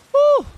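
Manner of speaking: very high, loud "woo"; short celebratory squeal, way up high; excited whoop. Real, not acted. Cheering a long subscription.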